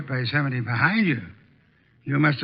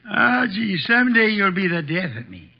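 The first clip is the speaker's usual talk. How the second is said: normal talking voice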